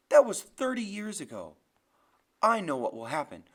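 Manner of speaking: loud, insistent argument; heated rebuttal. Acted. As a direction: press the point loudly against him. Graham argues back.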